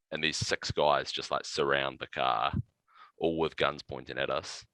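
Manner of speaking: ordinary delivery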